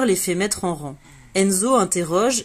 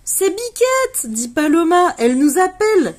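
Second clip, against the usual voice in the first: much higher and louder, thrilled; excited cry; 11 semitones higher, 6 dB louder than usual. Acted. Paloma hearing Biquette call to the friends, excited high cry. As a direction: burst out with the good news.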